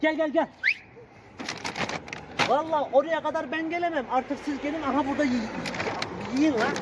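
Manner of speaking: high coaxing voice; coaxing the dogs. Real, not acted. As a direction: coax them to come and eat.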